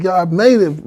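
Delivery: high-pitched, thrilled announcement; triumphant. Acted. Turkey announcing it reached the top, high triumphant voice. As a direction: celebrate with the pitch well up.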